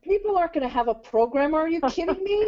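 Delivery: high-pitched, disbelieving objection; incredulous protest. Real, not acted. Co-host challenges the presenter over needing a programmer, incredulous.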